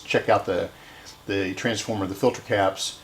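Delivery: ordinary talk, nothing marked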